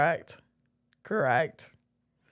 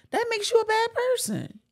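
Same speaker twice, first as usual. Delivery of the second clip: much higher, mocking squeak; 14 semitones higher than usual. Friend mocking a bad choice in a high squeaky voice. Repeating the judgement in a put-on voice.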